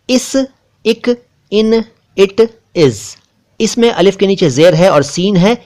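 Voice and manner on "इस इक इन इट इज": इस, इक, इन, इट, इज each spoken apart with a short i; crisp short words, pausing between them; word drill. Shown as a demonstration. Teacher reading short-i words to viewers. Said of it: "इसके शब्द देखिए".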